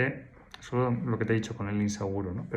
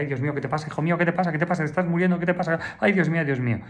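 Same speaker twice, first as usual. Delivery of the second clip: panicky parent; 5 semitones higher, faster than usual. Retold or imitated. Anxious parent rushing to a crying child, panicky and higher. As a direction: fret over the child as if something terrible happened. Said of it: "padres o madres que llegan ya al niño llorando y dicen".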